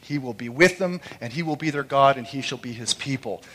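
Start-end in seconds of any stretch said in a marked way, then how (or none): none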